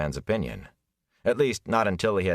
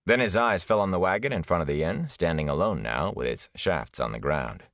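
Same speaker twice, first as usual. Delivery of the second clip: ordinary delivery, nothing marked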